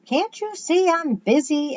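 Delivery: high, loud, drawn-out irritation; snappish. A narrator's reading. Boy too busy for his friend, snapping. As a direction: snap back in a high voice, annoyed. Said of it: "Eddie snapped".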